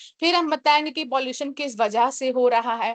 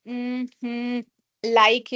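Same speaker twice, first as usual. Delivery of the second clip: filler sounds demo; much slower than usual. Shown as a demonstration. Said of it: "avoid filler words such as".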